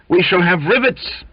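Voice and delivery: high, loud triumphant shout; joyful shout. Acted. Narrator shouting the news to the boiler-maker, loud and high. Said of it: "slapped him on the back and shouted".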